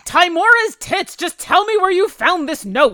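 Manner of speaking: high, loud, furious shout; furious outburst. Acted. Governor loses patience and shouts at the players. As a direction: blow up at them to stop stalling.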